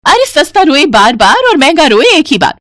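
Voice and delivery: proverb scolded. Acted. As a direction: scold with the proverb सस्ता रोए बार बार. Mother-in-law proverb.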